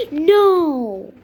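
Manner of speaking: gasped, loud, high protest; sudden burst of panic; alarmed cry. Real, not acted. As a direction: gasp and cry out in dismay. Gasps at setback in game.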